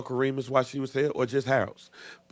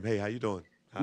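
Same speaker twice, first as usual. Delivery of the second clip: phone voice; 3 semitones lower, 7 dB quieter than usual. Retold or imitated. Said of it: "talking in his old phone voice".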